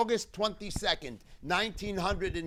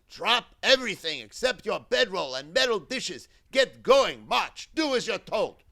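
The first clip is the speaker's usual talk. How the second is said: loud, raised-pitch barked orders; imitated guards' yelling; 4 semitones higher, 7 dB louder than usual. Guards driving arrivals off the train, yelling orders. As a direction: bark commands like a camp guard. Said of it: "we heard the guards yelling".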